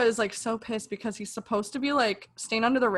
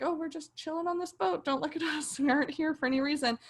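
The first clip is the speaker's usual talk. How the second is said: innocent act; 6 semitones higher than usual. Retold or imitated. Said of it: "Pope and Kiara have to be like".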